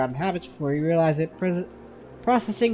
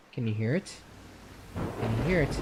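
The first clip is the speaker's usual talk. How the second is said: hushed; 4 semitones lower, 6 dB quieter than usual. Acted. Girl hushes listener to hear tide.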